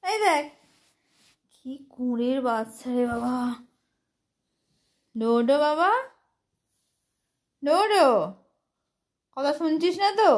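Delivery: high, loud calls to the pet; calling out. Real, not acted. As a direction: call the pet, rising.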